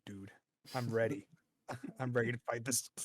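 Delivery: usual speaking voice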